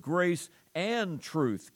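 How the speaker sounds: AND stressed and drawn out between grace and truth; slow down and lean hard on the word AND. Shown as a demonstration. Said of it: "Notice my emphasis on that word".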